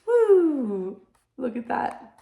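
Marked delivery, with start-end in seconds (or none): 0.1-1.0 gleeful swinging whoop. Acted. Boy swinging from branches.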